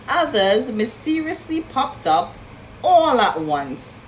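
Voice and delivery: ordinary delivery, nothing marked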